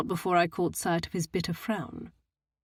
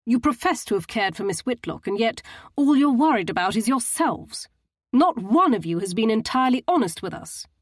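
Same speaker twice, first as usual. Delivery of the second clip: stern accusation; 4 semitones higher, 6 dB louder than usual. A narrator's reading. Husband's angry accusation.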